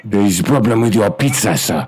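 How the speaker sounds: clipped broken English, hammed-up Chinese accent; mock Chinese accent.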